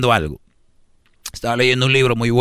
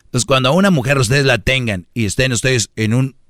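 ordinary talk, nothing marked

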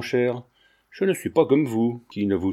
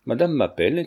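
ordinary delivery

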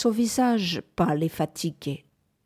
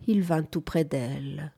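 plain reading voice

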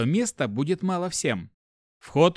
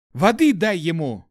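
urgent shout; 4 semitones higher, 6 dB louder than usual. Acted. Urgent call amid coughing fit.

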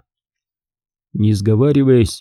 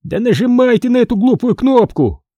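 impatient snap; 11 semitones higher than usual. A narrator's reading. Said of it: "нетерпеливо рявкнул Джоуи".

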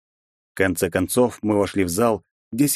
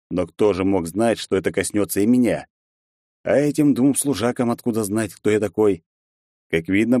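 ordinary delivery, nothing marked

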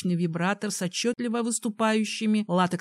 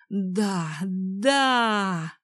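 sobbing shout. Acted. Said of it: "рыдая, кричала".

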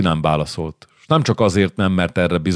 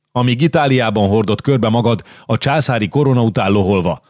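raging shout; 5 semitones higher than usual. Acted. Milan's duke rages at the king, shouting. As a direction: scream it out beside himself. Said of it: "magából kikelve üvöltött".

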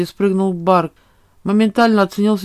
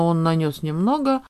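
ordinary delivery

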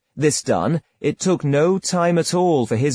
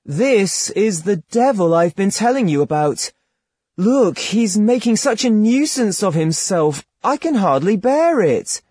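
Rustico; 7 semitones higher, 3 dB louder than usual. A narrator's reading. Rustico complains, voiced higher.

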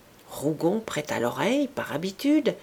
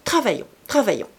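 higher, louder playful reproach, hurrying at the end; mild scolding; 6 semitones higher, 5 dB louder than usual. Acted. Clorinde scolding the noisy gentlemen, playfully raised. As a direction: tease them back to work.

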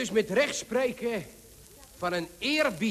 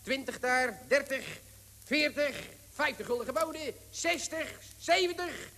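quick rising auction patter, climbing higher; auctioneer; 5 semitones higher than usual. Acted. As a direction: rattle off bids like an auctioneer. Auctioneer calls rising bids.

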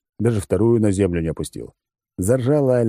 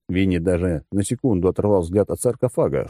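usual speaking voice

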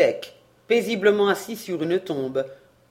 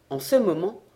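usual speaking voice

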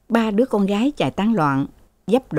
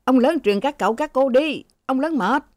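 higher-pitched announcement, normal volume; mildly raised acted call; speaking up; 6 semitones higher than usual. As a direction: relay the master's order to the quarrellers. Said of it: "Hai điều nói to".

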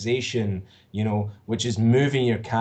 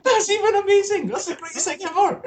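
high, squealing laughter through the words; can barely get it out for laughing; real laughter at a friend's failed audition; laughing hard. Real, not acted.

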